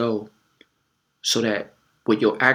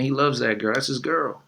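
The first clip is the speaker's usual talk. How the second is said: ordinary talk, nothing marked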